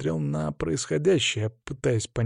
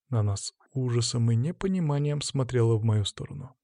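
ordinary delivery, nothing marked

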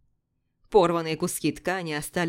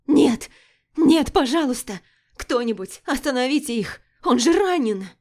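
high, loud, desperate cries; panicked pleading; 6 semitones higher, 6 dB louder than usual. Acted. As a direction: beg in pure panic. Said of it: "Голос срывался от паники и отчаяния".

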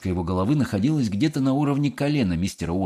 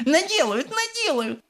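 very high-pitched repeated wail; hysterical insisting. A narrator's reading. Mother contradicting Wonka, hysterical. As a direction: shriek it, climbing higher the second time.

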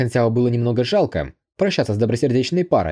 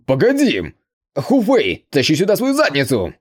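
higher and louder, bellowing the name; roar; 5 semitones higher, 3 dB louder than usual. A narrator's reading. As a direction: summon the boy with a roar. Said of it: "Быстро проревел он".

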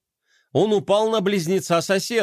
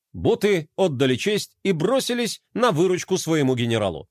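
ordinary delivery

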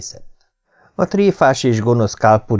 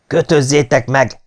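higher, firm order; commanding voice; 5 semitones higher than usual. A narrator's reading. Captain ordering men to tie up the attacker, commanding. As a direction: give the order as one used to command. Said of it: "egy parancsoláshoz szokott hang".

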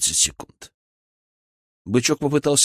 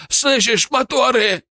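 high, loud alarmed call; urgent shout; 13 semitones higher, 5 dB louder, slower than usual. A narrator's reading. Козёл alerts Бычок to the motors, urgent shout. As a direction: call out the warning over a distance. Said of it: "крикнул козёл".

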